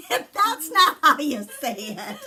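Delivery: loud, high, bursting with laughter; laughing correction. Real, not acted. Correcting a mispronounced spice name, laughing hard. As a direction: crack up while objecting, voice jumping up.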